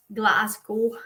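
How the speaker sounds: badly faked Scottish accent on a place name. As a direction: have a poor go at sounding Scottish. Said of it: "That's probably the worst Scottish accent".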